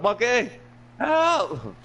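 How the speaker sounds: very high, squealed comic plea; mock cry for help. Scared storyteller calls to Monkey, high mock plea. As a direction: squeal for rescue in mock panic.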